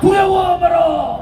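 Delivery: slow, shouted rally chant; roaring rallying speech. Acted. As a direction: drag each word out for the crowd.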